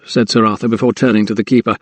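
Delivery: ordinary delivery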